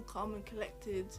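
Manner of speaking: normal talking voice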